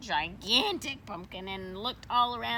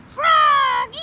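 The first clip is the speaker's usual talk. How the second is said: loud, high call across the field; 15 dB louder than usual. Acted. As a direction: call him from far away. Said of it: "called Miss Witherspoon".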